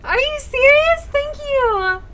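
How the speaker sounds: loud, high-pitched disbelief; stunned excitement. Real, not acted. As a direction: burst out, overwhelmed by the good news. Streamer stunned by follower milestone.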